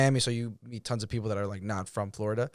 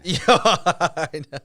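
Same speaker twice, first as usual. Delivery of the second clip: laughing; 6 semitones higher, 7 dB louder, much slower than usual. Real, not acted. Laughing at the Hennessy joke.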